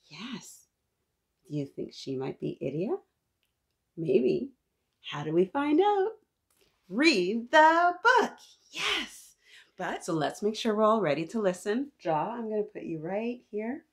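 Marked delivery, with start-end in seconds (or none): 0.1-0.7 breathy hushed yes; whisper. Real, not acted. Teacher whispering yes to the children about the girl on the cover. 6.9-9.4 high, loud and slow; emphatic answer. Real, not acted. Teacher answering how we find out, high, loud and slow.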